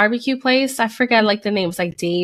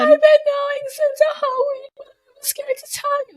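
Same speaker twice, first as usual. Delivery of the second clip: very high wailing confession, fading to a squeak; imitating a hysterical tearful squeal; mock crying. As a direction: blubber it out, then lose the volume. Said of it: "So I'm, like, crying".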